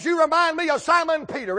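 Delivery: retold rebuke, loud and high; loud retold accusation. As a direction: holler the comparison at him. Retold quarrel in the boat.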